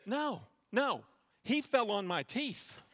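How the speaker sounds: high-pitched protest, slowing on the excuse; mock-innocent denial. Boy lying to dad about biting, mock innocence. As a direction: play the kid selling a feeble excuse. Retelling his boyhood lie to dad.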